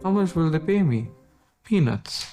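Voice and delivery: ordinary talk, nothing marked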